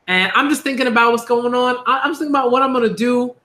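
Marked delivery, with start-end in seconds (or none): none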